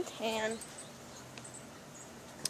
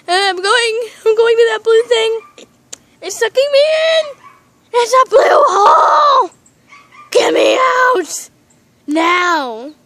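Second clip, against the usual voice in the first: loud, high, crying panic; panicked yelling; 5 semitones higher, 12 dB louder, slower than usual. Pretend. Pet sucked toward the blue hole, crying panicked yells. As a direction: scream for rescue as it pulls you in.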